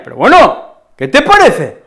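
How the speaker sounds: agudo y fuerte, lleno de ánimo; animar a un niño. Acted. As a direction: celebra el dibujo de un niño. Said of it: "Con un niño que ha terminado". (a un niño que enseña su dibujo, animando con voz aguda)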